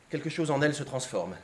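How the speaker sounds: ordinary delivery, nothing marked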